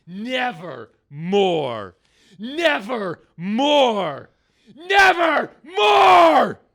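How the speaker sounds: slow, drawn-out Nevermore rising to a loud shout; building to a shout. Acted. Actor ending his rant, building to a shout.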